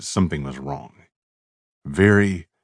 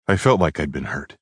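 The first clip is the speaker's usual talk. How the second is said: ordinary delivery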